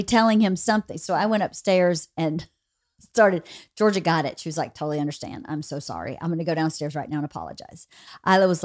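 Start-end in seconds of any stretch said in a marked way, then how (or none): 4.7-7.9 low, soft, quick murmur; understated sorry, hurried and hushed; retold meek apology. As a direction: voice a contrite teen, dropped low and quiet. Voices daughter's contrite apology.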